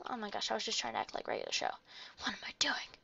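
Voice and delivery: fast, soft self-mocking aside; embarrassed whisper. Real, not acted. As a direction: hush it, caught out.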